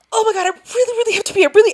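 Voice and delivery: high-pitched fake desperate pleading; play-acted urgent plea, way up high; pretend urgency. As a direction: beg as if bursting, hammed up. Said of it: "pretend you have to pee really bad".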